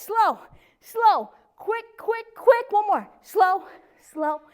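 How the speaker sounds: chanted beat, long then short words; rhythmic workout count. Shown as a demonstration. Instructor counting the final leg lifts, rhythmic cadence. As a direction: keep the last repetitions in strict time. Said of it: "we're gonna do quick".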